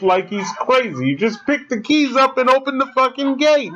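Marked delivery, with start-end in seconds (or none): 1.1-3.7 high, exasperated outburst; telling a movie character off in disbelief; frustrated yell at the screen. Real, not acted.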